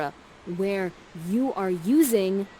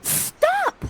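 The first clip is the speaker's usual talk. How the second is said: put-on much higher, girlish plea; pleading young Miranda. Miranda begging her mother to stop the fight, high girlish plea. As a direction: squeal it like a lovestruck teen daughter. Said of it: "And Miranda says this".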